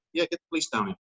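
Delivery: high, put-on voice of a security guard on the radio; mock guard on radio.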